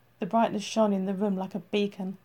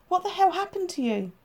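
alarmed; 10 semitones higher than usual. Acted. She sees his state.

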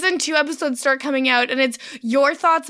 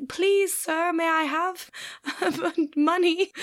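higher, softened mock begging; pleading beggar; 3 semitones higher, 4 dB quieter, slower than usual. Podcaster plugs her PayPal, playing a pleading beggar. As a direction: beg politely, breaking into a laugh. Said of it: "I'm like a beggar".

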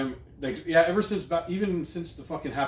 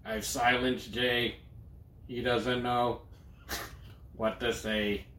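slow, flat robotic monotone; even, machine-like delivery; Stephen Hawking voice; 6 dB quieter, much slower than usual. Pretend. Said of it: "This is my Stephen Hawking voice".

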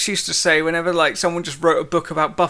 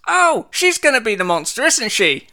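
higher, louder sudden realization; imitated wife; 7 semitones higher, 4 dB louder than usual. Watching with husband, wife guesses the twist, excited realization. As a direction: blurt it out as a spoiler. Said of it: "my wife just went".

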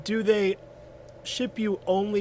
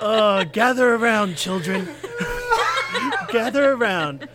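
slow wise elder; 4 semitones higher, 6 dB louder than usual. Pretend. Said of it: "heard the legend of Johnny Mantis Seed".

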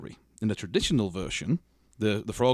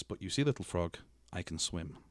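low, hushed and sly; quiet menace; 5 semitones lower, 9 dB quieter than usual. Acted. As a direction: let the twist drop softly, like a threat. Scorpion's menacing twist punchline.

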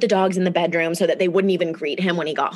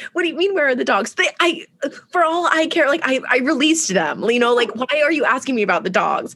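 high, exasperated retort; acted indignation; 6 semitones higher than usual. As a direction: replay a flustered argument, pitch up. Acted quarrel over the dogs.

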